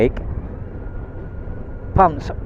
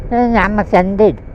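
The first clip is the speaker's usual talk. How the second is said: higher, slow sneering mimicry of a whiner; mocking whine; 6 semitones higher, slower than usual. Ranter mocking lefties who take offence, sneering and slow. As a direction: drag it out in a snide pitched-up voice.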